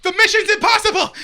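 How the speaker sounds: high, loud hammed-up exclamation; laughing, over-the-top shout; mock dramatic cry. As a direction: yell it like a silly action hero. Jokey movie-title exclamation, laughing.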